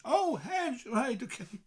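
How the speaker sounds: imitating a local catching on; mock realisation. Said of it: "they'd say in English".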